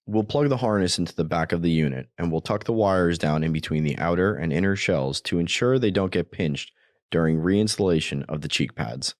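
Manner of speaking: ordinary delivery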